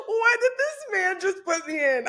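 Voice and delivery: high, laughing disbelief. Real, not acted. Guest teasing the host who invited her, laughing. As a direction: ask it while cracking up.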